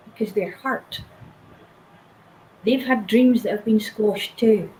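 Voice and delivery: usual speaking voice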